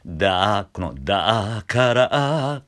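slow creaky rise into だから; vocal fry scooping up from below; edge voice. Shown as a demonstration. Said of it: "下からしゃくって".

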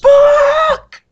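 sudden high loud shout of disbelief; shocked shout. Real, not acted. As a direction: blurt it out in shock. Shocked at the low price.

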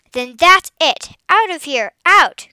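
loud, high angry order, building; roaring. A narrator's reading. Girl throwing boy off the farm, roaring. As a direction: bellow it, climbing to the last word. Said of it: "Graciela roared".

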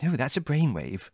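high, light motherly approval; delighted exclamation. A narrator's reading. Mother relieved the problem is solved, delighted. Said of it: "Mother exclaimed, delighted".

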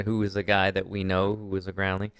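ordinary talk

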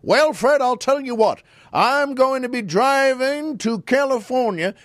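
loud, high blustering senator; Senator Claghorn. Retold or imitated. Imitating a radio senator addressing the host, always loud. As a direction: boom it out like an old radio blowhard. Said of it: "it was always loud like that".

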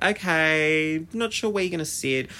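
doubtful first impression; wary okay. Acting own wary reaction.

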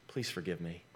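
very soft, pleading; a quiet, humble plea for forgiveness; hushed plea. Shown as a demonstration. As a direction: barely voice the request for forgiveness.

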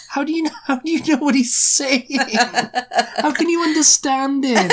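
can't believe she understands; incredulous. Retold or imitated. Amazed at sister understanding him.

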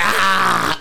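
loud, high whooping cry; mock war yell. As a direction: let out a hyped war cry. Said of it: "Comanche yell".

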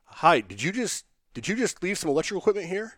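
a hint of a puzzled receptionist; receptionist on phone.

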